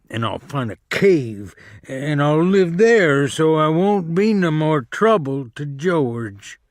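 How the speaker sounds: high pleading lament, a touch of grief; moaning with grief. A narrator's reading. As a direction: moan it like a hurt, simple man. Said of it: "Lenny moaned with grief".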